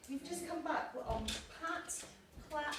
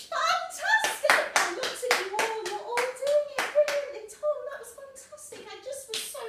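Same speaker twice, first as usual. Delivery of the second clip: thrilled praise; 12 semitones higher, 12 dB louder than usual. Real, not acted. Teacher praises the class after the pat and freeze game. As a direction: burst with pride at the whole class.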